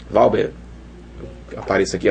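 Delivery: usual speaking voice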